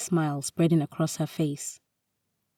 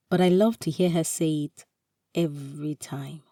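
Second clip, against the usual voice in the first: ordinary delivery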